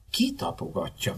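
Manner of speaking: understated huffy retort; low-key, affronted comeback; offended. A narrator's reading. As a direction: answer softly in injured pride. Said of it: "mondtam sértődötten".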